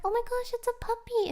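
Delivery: high, fast excited squeal; gushing puppy delight. Retold or imitated. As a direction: squeal at a dog. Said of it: "I'm like".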